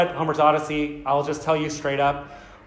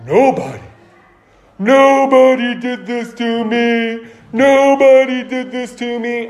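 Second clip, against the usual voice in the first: confused Cyclops; 5 semitones higher, 6 dB louder than usual. Retold or imitated. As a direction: howl the name like a dazed monster. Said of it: "So Cyclops is going".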